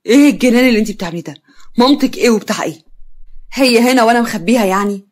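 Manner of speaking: loud, fast, indignant outburst; angry. Acted. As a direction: hit back angrily at the accusation. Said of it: "كريم بغضب".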